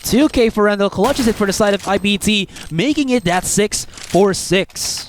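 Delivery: high, hyped clutch call; esports caster. Real, not acted. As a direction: shout the clutch as it happens. Clutch moment called live.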